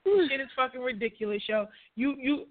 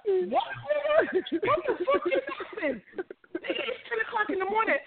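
much higher, slow, stunned; shocked disbelief; 8 semitones higher than usual. Real, not acted. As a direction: react like you can't believe what just happened. Shocked at Bill's early call.

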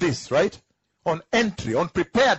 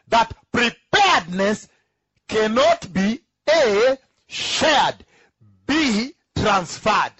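slower, louder preaching cadence; emphatic preaching; 3 semitones higher, 4 dB louder, slower than usual. Acted. Preacher pressing the point on the church, emphatic. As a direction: drive each point home from the pulpit.

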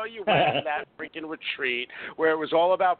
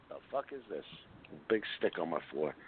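ordinary talk

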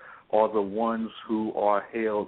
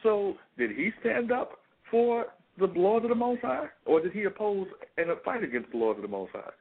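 rising, much higher fervent sermon voice; impassioned preaching; 8 semitones higher than usual. Acted. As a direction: preach it with mounting fire. Preaching rises to full force.

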